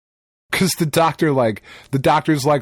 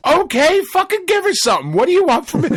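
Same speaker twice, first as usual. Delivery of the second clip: exasperated; 13 semitones higher, 4 dB louder than usual. Real, not acted. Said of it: "exasperated outburst".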